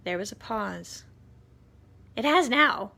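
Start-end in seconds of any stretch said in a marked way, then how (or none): 2.2-2.9 insistent. Acted. Aunt insists.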